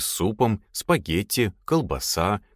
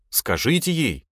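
higher, pleading complaint; complaining; 6 semitones higher than usual. A narrator's reading. Lad wants the commander to step in, complaining. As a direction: appeal to the boss, aggrieved. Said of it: "пожаловался он".

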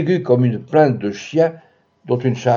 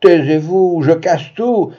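strained outburst; 5 semitones higher, 4 dB louder, slower than usual. A narrator's reading. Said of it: "L'énergie d'un effort suprême".

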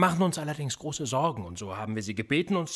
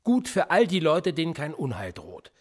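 higher, louder pointed comeback; heated retort; 4 semitones higher, 4 dB louder than usual. Acted. Interrogator answers suspect who denies any danger, heated retort. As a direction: throw it back at them.

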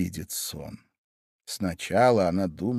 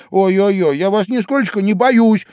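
high, loud, defiant mockery; Alice openly defying the queen; 9 semitones higher, 9 dB louder than usual. A narrator's reading. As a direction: taunt the queen. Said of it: "сказала Алиса".

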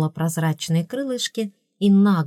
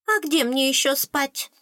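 high-pitched testy retort; snappish; 7 semitones higher than usual. A narrator's reading. Fairy answering the scolding princess, high and snappish. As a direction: snap back in a high fairy voice. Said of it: "огрызнулась Аскалка".

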